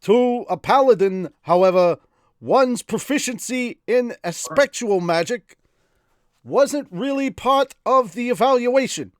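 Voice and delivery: high, loud put-on reading voice; character reading voice. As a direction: read the lore in the silly voice. Reads novel in put-on voice.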